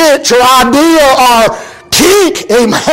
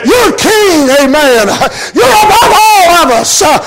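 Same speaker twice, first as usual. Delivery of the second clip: fervent preaching; 4 semitones higher than usual. Acted. Preacher crying out the blind man's praise, full force. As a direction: proclaim it as if to the king.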